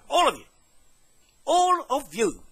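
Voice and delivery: high, emphatic angry repeat; angry accusation. Real, not acted. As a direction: hurl it at the whole lot. Threatening the whole lot, repeated.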